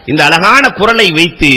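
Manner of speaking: ordinary talk, nothing marked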